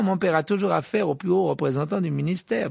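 Malfoy's own original boast; smug boast. Acted. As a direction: preen about his father.

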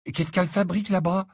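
high, fretful question; anxious. A narrator's reading. Ron hurries behind Harry asking after her, anxious. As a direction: ask with eager worry. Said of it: "interrogea Ron, anxieux".

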